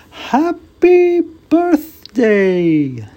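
very high, slow, loud snowman. Acted. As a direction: drawl it like a jolly cartoon snowman. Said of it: "said the snowman".

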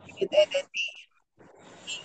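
a bare whisper, barely voiced. Real, not acted. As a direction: whisper it as if too hard to say aloud. Hard admission about the abuse.

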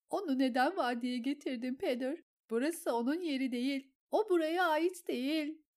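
high, loud, teary protest; tearful outrage. A narrator's reading. Lysa pleads tearfully with Petyr. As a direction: demand it through tears.

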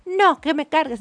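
put-on whiny demand like a two-year-old; niña berrinchuda. Niña exigiendo en el súper. (niña caprichosa exigiendo)

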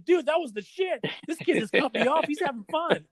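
high-pitched imitation of a delighted skater; amused enthusiasm. Tony tells everyone about the kid cutting him off, delighted. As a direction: retell it with high, excited glee.

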